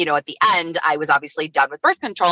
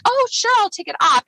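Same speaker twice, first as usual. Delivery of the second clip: high-pitched feigned casualness; mock-innocent lilt; playing along. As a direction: act breezily agreeable, pitched well up. Playing along with photographer's cue.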